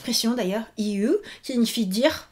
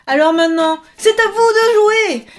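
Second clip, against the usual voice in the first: excited call; 9 semitones higher, 9 dB louder than usual. Real, not acted. Teacher launches the quiz.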